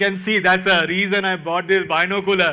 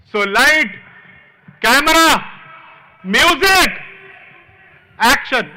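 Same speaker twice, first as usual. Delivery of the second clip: louder and much higher, Bollywood director calling the shot; filmy style; 6 semitones higher, 6 dB louder than usual. Pretend. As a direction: announce it like a film director starting a take. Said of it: "Let's in a filmy style".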